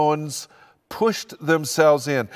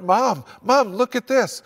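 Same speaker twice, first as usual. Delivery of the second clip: higher, eager mock kid calling "Mom, Mom"; excited child calling; 7 semitones higher than usual. Grandchild calling mom to see his Lego, excited and higher. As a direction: show off the Lego masterpiece to mom. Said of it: "and they cry out".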